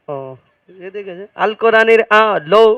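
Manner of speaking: ordinary talk, nothing marked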